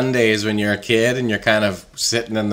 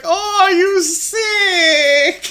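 put-on high, slow accented fussing mom; mock South American mother fussing; 4 dB louder, much slower than usual. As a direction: stretch it out, worried, thick accent. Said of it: "He's like".

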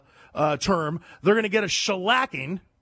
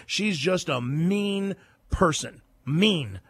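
slow, deliberate emphasis; much slower than usual. Real, not acted. Repeated insult, slowed for weight.

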